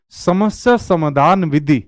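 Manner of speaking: slow, word by word; slow spelled out. Shown as a demonstration. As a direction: spell out समस्या समाधान विधि slowly. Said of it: "इसका नाम से पता नहीं चल रहा". (धीरे धीरे, हर शब्द अलग से)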